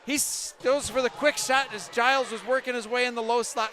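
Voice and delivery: loud, rising fast commentary; excited play-by-play. Real, not acted. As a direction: call the rush with building tension. Intense live play-by-play.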